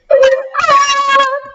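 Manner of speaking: loud high laugh; high burst of laughter. Real, not acted. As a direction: break into a shrieking laugh. Laugh after scary whisper.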